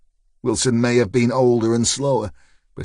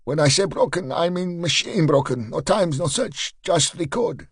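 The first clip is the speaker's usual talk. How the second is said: higher, insistent Eastern European voice; explain, accented and earnest; 6 semitones higher than usual. A narrator's reading. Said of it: "in an Eastern European accent".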